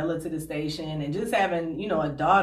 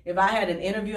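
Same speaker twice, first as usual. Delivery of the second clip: usual speaking voice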